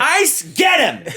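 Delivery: high, loud, slowed-down comic yell; mock shout. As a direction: bellow it like calling a bouncer. Joke command to throw him out.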